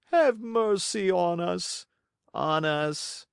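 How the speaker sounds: high, slow begging whine; pleading fox. Acted. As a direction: grovel for mercy.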